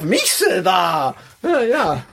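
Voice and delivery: put-on slow shouted words in a Russian accent; Russian accent German. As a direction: call it out like a Russian speaking German. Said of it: "das liegt an seinem russischen Akzent".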